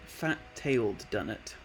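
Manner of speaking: usual speaking voice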